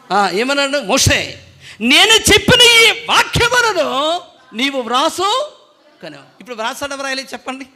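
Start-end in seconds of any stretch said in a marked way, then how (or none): 1.8-6.2 high, loud, slow divine command; God commanding. Acted. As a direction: boom it out as God giving an order. Pastor voices God's command.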